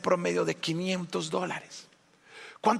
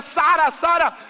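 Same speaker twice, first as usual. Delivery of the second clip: high, loud cry of a name; shouting; 11 semitones higher, 9 dB louder, slower than usual. Acted. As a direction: yell for your lost child. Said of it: "a gritar". (gritando angustiado)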